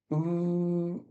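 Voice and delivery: Oo held long with lips close together; a long, high-pitched Oo; close vowel. Shown as a demonstration. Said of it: "very close together".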